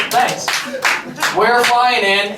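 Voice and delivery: ordinary delivery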